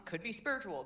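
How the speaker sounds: ordinary talk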